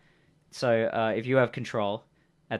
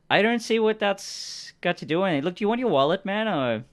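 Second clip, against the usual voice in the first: higher-pitched, pushy character voice; impatient stranger voice, raised in pitch; defensive stranger; 9 semitones higher, 4 dB louder than usual. Acted. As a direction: push back, evading the question. Stranger pushes back on question.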